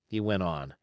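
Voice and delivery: usual speaking voice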